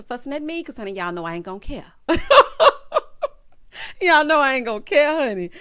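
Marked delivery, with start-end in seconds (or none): 2.1-4.0 loud high cackle; big laugh. Real, not acted. Laughs at her own remark. 4.0-5.5 loud and high, straight out of a laugh; amused outburst. Real, not acted. Repeats her remark after laughing.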